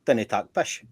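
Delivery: Scots dialect demo, run together; broad Scots. Shown as a demonstration. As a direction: say it as a Scot would, in dialect. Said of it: "We'll say".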